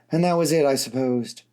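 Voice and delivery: usual speaking voice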